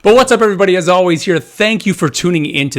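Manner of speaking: loud host welcome. Real, not acted.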